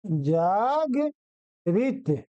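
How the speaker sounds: जागृत very slow, jaag, pause, rit; correct pronunciation. Shown as a demonstration. Teacher dictates the correct form, जागृत slowly split jaag-rit. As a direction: break जागृत after a long jaag. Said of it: "इसका शुद्ध रूप होगा".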